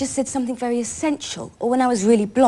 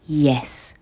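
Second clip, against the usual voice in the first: low, brief imitation of an analyst; imitated analyst; 8 semitones lower than usual. Patient shows what the analyst would say, low imitation. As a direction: drop your pitch to mimic the analyst. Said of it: "he would also say".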